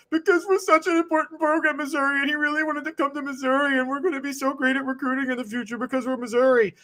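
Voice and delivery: high whiny mocking of a Missouri fan. Mocking Missouri fans who cried about the jokes, whiny high voice. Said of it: "That was a whiny voice".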